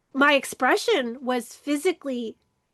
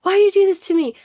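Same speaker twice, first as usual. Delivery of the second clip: higher, louder mock wail of reproach; retold tearful plea; 6 semitones higher, 7 dB louder, much faster than usual. Daughter quoting her crying mom, mock distressed plea. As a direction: imitate an upset mother's protest. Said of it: "cries and she's like".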